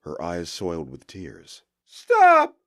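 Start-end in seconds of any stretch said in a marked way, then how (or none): 1.8-2.6 high, loud desperate screaming plea; desperate shout. A narrator's reading. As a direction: scream it at the oncoming train. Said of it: "she cried".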